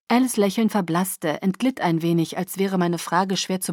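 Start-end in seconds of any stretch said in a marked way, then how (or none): none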